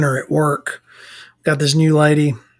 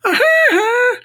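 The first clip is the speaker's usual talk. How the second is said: high cartoon "ha ha" on repeat; Simpsons character's taunt; 3 dB louder, much slower than usual. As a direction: imitate the repeated taunting catchphrase. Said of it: "he's still going".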